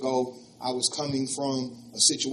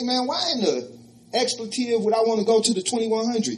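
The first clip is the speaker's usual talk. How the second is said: offended outburst; 8 semitones higher, 3 dB louder than usual. Retold or imitated.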